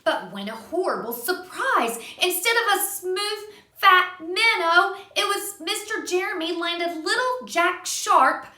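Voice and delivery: put-on gentle read-aloud for a child; reading to a little one; storybook narration. A narrator's reading. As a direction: tell it like a bedtime story. Reading a picture book aloud.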